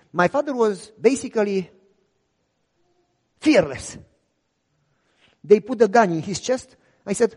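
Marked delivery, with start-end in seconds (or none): none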